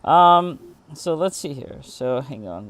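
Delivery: ordinary delivery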